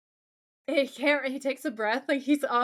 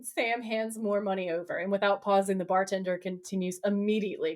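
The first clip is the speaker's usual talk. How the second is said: ordinary delivery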